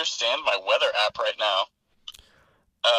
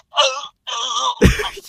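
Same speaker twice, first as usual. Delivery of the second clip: mock pained groan; 11 semitones higher, 6 dB louder than usual. Frodo sitting there like.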